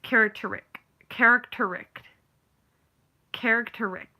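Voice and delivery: "Characteric" with the -ist- dropped, care-ik-TER-ik; imitated mispronunciation. Imitating how she said it, mispronounced word. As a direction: repeat "Characteric", swallowing a syllable. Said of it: "how did she say it?".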